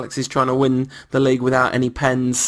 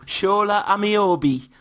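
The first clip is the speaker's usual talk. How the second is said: slow Newcastle accent, pitched up, on "Shola Ameobi"; 7 semitones higher, slower than usual. Shown as a demonstration. Warming up his Newcastle accent for his co-host. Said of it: "That's how you get into a Newcastle accent".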